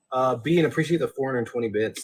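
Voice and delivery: ordinary talk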